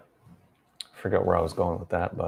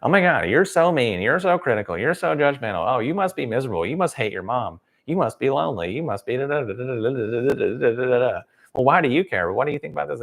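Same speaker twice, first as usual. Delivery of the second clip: mocking whiny voice; 5 semitones higher than usual. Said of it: "They're being like".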